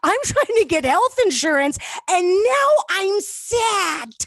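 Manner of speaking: high, slow whining wail; hammed-up whimper; mock whine. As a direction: drag out the sad part like a pouting child. Whining about being sad.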